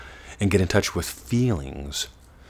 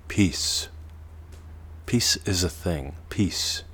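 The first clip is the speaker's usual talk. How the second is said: usual speaking voice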